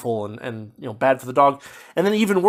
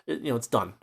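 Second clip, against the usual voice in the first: normal talking voice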